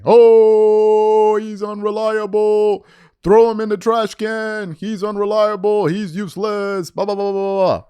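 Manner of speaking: slow, drawn-out parody of whiny fans; mocking complainers. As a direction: stretch it out like a sneering complainer. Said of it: "some of these guys were complaining about A D".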